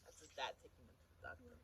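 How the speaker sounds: ordinary delivery, nothing marked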